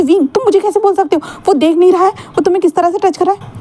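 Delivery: high-pitched, agitated complaint; upset jealous protest. Acted. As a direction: voice jumps up in jealous alarm. Jealous partner protests doctor touching.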